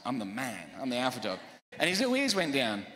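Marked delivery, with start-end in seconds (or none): 0.1-1.4 low, quiet boss-of-the-house voice; mock alpha dog. Owner asserting himself over his growling puppy, low and mock commanding. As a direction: talk down to the puppy like the pack leader. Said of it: "was like".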